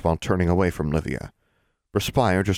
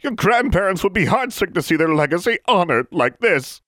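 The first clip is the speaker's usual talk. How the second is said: put-on much higher, louder sharp old woman's snap; snapped rebuke; 12 semitones higher, 4 dB louder than usual. Goody Pruitt snapping at Livia. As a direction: bite the words off at her.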